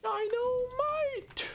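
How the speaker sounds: high, slow, stretched-out cry; excited squeal. Real, not acted. Gamer spots the dynamite, high drawn-out cry. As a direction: stretch the word like a big reveal. Excited shout at item name.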